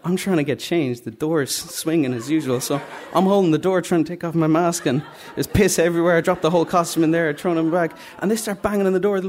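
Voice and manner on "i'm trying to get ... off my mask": ordinary delivery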